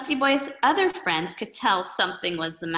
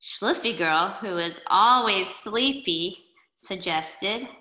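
usual speaking voice

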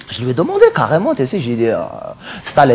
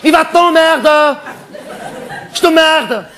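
mock insult; 10 semitones higher, slower than usual. Insulting a heckler.

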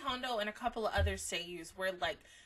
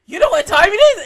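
loud, fast, urgent mom demand; mom scolding; 10 semitones higher, 21 dB louder, faster than usual. Retold or imitated. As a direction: rattle it off like a panicked mother. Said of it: "And she's like".

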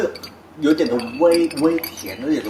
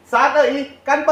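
excited toast; 11 semitones higher, 4 dB louder, much slower than usual. Real, not acted. Host leads a toast.

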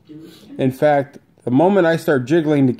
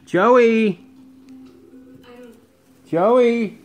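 high pet-calling voice; 9 semitones higher than usual. Real, not acted. Owner calling his parrot Joey to come back, high sing-song. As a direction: coax the parrot over in a high, lilting voice.

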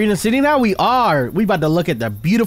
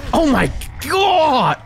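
high, slow, stretched exclamation; amazed cry; 7 semitones higher, much slower than usual. Real, not acted. Gamer amazed by the fight, high drawn-out cry. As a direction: draw it out high in disbelief.

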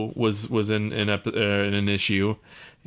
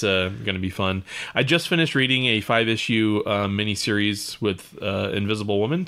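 ordinary talk, nothing marked

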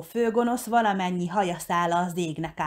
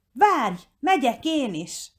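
higher, slow call after someone; 7 semitones higher, 3 dB louder, slower than usual. A narrator's reading. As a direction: call out to stop a friend leaving. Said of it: "kiáltotta". (utána kiáltva)

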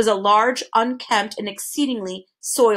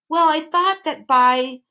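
higher, slow, desperate attempt; hesitant plea, pitched up; 7 semitones higher, 4 dB louder, slower than usual. A narrator's reading. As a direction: try desperately to explain. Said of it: "he tried again desperately".

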